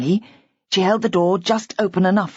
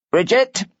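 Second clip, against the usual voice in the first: calling with authority; 3 semitones higher than usual. A narrator's reading. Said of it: "Glenn called with authority".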